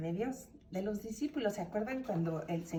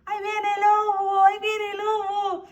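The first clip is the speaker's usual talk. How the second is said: high, loud sing-song holler of a prankster; crying wolf at full voice; false alarm cry; 14 semitones higher, 12 dB louder than usual. Acted. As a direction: shout it out in long, ringing tones to scare everyone. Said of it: "Él les decía a los demás".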